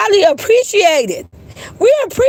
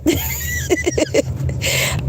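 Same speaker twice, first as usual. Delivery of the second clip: shrieking laugh; 6 dB quieter than usual. Real, not acted. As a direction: burst out in a high shriek. Cracking up mid-sentence.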